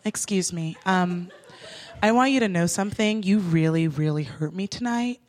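low, measured mock-solemn address; chill, earnest speech. Speech to the cab driver after police come, chill. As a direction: deliver a hushed, hurt little speech with pauses. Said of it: "very choice, like, very chill words".

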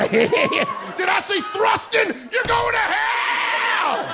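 very high, slow, laughing mock fury; mock furious God. God catching them thrusting, hammed-up furious yell. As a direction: bellow the damnation like a cartoon God.

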